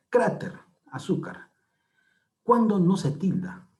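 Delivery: ordinary talk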